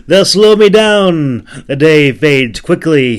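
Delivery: high, loud, slow shout over distance; shouted back. Acted. Warrior shouting back to the boatman while walking away, high loud shout. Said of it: "he shouted back".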